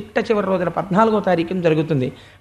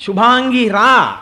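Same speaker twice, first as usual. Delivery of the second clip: loud, higher, drawn-out summons; కృష్ణుడు calling loudly; 4 semitones higher, 7 dB louder, slower than usual. Acted. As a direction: call the cow over from far away, slowly. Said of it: "అని గట్టిగా పిలిస్తే". (గట్టిగా, సాగదీస్తూ రమ్మని పిలుపు)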